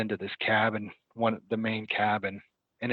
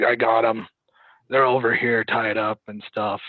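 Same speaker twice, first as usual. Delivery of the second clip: loud bragging; 7 dB louder than usual. Retold or imitated. Captor bragging to cops.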